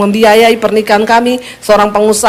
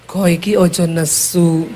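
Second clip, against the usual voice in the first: low, slow, soft plea; hushed and drawn-out Javanese; gentle Javanese husband; 8 semitones lower, 6 dB quieter than usual. Retold or imitated. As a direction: imitate a meek Javanese husband soothing his wife. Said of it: "Tapi nadamu tuh tinggi".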